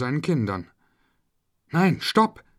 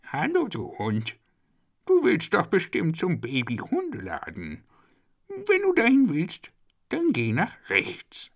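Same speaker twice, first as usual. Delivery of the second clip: squeaky worm voice; 8 semitones higher than usual. Acted. Worm character speaks.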